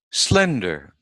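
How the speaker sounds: teacher's careful SLENDER, stress up front; first-syllable stress. Shown as a demonstration. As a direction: punch the SLEN of SLENDER. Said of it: "we stress the first syllable".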